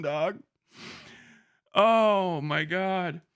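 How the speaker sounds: usual speaking voice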